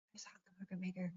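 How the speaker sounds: whispered name, fast; retold whisper. Husband whispers caller's name to wife, quick whisper. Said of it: "And he says".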